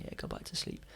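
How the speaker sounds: half-whispered, soft and breathy; whispered reassurance. Retold or imitated. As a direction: hush him back to sleep in a whisper. Max soothing him at night.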